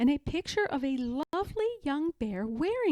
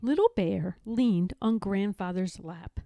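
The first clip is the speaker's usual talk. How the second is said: ordinary delivery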